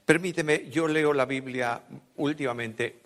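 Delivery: ordinary talk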